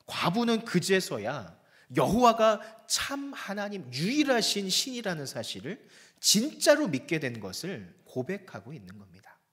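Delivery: ordinary talk, nothing marked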